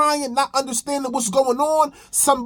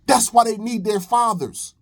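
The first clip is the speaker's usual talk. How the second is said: ordinary talk, nothing marked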